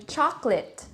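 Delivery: chocolate in two syllables, CHOK-lit; dictionary pronunciation. Shown as a demonstration. Teacher quoting the dictionary for learners, careful pronunciation. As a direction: say chocolate with the middle vowel dropped. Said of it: "Some dictionaries would give you".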